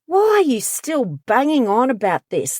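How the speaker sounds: impatient, belittling question; fed-up mocking whine; exasperated jab. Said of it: "classic statements that come from the narcissist".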